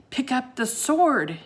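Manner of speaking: slowed, firm urgent call; shouted. A narrator's reading. Jack urges Annie to lift the sword, urgent shout. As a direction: order her to grab the sword. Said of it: "he shouted at Annie".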